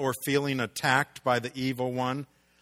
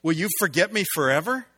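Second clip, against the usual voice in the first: pleading lament; 4 semitones higher, 6 dB louder than usual. Acted. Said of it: "Here's what the psalmist says".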